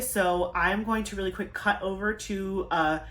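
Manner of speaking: ordinary talk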